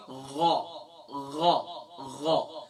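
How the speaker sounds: "غ" voiced from the top of the throat; short, low, separate demonstrations of "غ"; throaty ghain sound. Shown as a demonstration. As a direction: model "غ" as a throat fricative, never a g. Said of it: "آواز کیا ہوگی؟".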